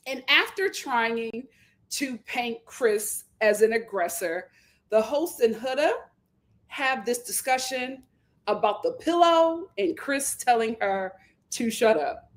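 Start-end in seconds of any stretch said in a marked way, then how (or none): none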